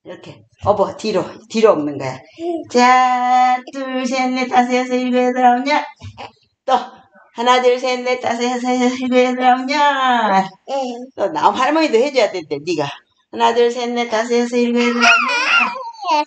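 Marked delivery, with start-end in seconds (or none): 2.7-10.5 higher singsong counting; counting for a child. Acted. Grandma counting exercise reps aloud for her toddler. 13.3-16.3 higher singsong counting; counting for a child. Acted. Grandma counting exercise reps aloud for her toddler.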